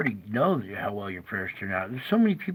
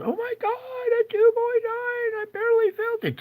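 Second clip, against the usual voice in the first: mock frightened local; 5 dB louder than usual. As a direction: ham up a terrified yokel over a tiny quake. Mocking rattled New Hampshire folks.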